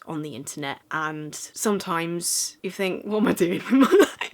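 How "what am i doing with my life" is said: amused disbelief, cracking up mid-question; laughing self-mockery. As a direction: break into a laugh before the last word. Acted self-question breaking into laughter.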